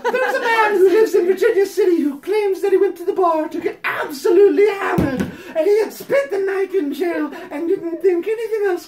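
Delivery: hammed-up letter voice. Reading the letter to Patty, hammed-up prim voice. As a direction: read it like a sanctimonious fan pouring her heart out. Reads fan letter aloud.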